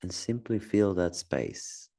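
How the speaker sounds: calm, spacious delivery with long silences; unhurried meditation voice, phrase by phrase; guided meditation. Acted. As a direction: gently guide someone through noticing their breath. Leads a guided breathing meditation.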